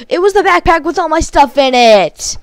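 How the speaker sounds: high, loud frustrated yell of realization; angry shout. Real, not acted. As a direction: shout it at the screen in real rage. Realizes backpack was lost, yells.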